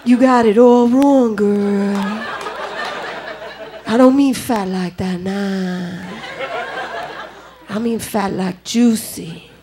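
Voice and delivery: low, slow, drawn-out crooner talk; slow jams voice. Acted. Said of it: "put on this bullshit slow jams voice".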